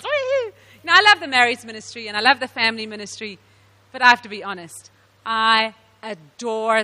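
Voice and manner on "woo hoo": high whoop of joy; cheer. Real, not acted. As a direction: whoop for the singles. Cheering for singles ministry.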